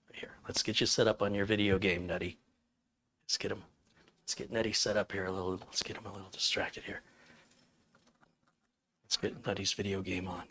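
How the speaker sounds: soft, half-whispered settling talk; hushed calming. Acted. Streamer quietly setting Nutty up with a game, hushed.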